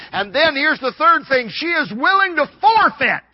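high, loud pulpit delivery; rousing sermon shout; full-force preaching. Real, not acted. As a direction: drive the point home at full voice.

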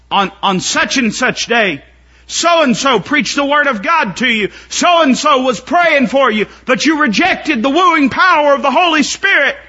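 high, loud preaching climb; rising sermon fervor; preaching at full force. Acted. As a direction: thunder it from the pulpit.

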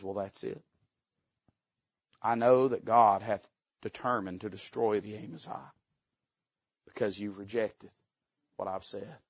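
soft, low, resigned murmur; quietly defeated prophet. Retold or imitated. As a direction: say it to yourself, turning away. Prophet turns away resigned.

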